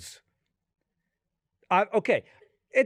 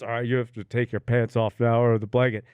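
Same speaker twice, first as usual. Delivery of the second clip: much lower, blunt orderly voice; gruff nurse guy; 11 semitones lower than usual. Retold or imitated. Guy in the exam room ordering the patient, in a low blunt voice. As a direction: drop way down and play the no-nonsense guy. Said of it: "He goes".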